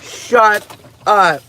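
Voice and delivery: thrilled at the gift; delighted disbelief at the unboxing. Real, not acted.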